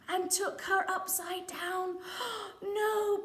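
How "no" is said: high gasp, then a crying no. Acted. Reading to a child, gasping as Pearl is seized. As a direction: gasp and cry out no. Shocked reader.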